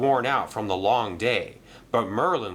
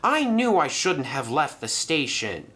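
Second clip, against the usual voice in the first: a bit higher, annoyed with herself; lightly voiced regretful Madge; 6 semitones higher than usual. Madge grumbling to Mister Percival, lightly higher. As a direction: grumble at your mistake.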